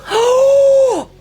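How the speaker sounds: a loud, high gasp of delight; excited gasp. Real, not acted. Streamer gasps with joy at the surprise raid. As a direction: gasp as a huge raid rolls in.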